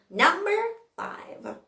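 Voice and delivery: high-pitched number, then whispered five; dramatic reveal. Real, not acted. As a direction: reveal it with suspense.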